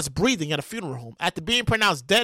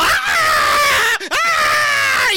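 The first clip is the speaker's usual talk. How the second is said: high, loud screams back in fright; screaming back at the casket; high, loud answering shrieks of fear; 6 dB louder than usual. Acted. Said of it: "You go".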